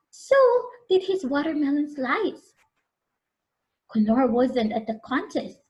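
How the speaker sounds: plain reading voice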